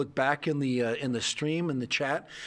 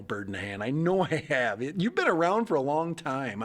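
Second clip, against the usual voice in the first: usual speaking voice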